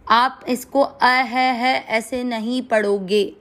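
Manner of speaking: ordinary talk, nothing marked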